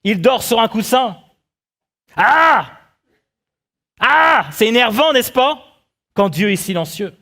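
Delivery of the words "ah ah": short open-mouthed "ah" yelled in exasperation; frustrated mock scream, pitched up; exasperated yell. Preacher acting disciples annoyed by sleeping Jesus, exasperated yell.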